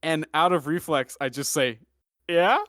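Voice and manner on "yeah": higher, questioning yeah; bright rising retort; mocking reply. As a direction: toss it back as a laughing challenge. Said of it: "I laugh in his face".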